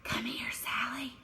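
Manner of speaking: whispered, voiceless and hushed; secretive whisper. Shown as a demonstration. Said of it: "if I were trying to be secretive".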